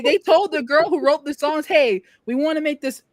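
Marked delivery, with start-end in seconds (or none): none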